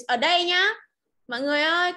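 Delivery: ordinary talk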